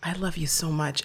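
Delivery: whispered tender plea; father whispering love over the phone; soft whisper. Retold or imitated. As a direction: hush it to her gently. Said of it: "He was so soft".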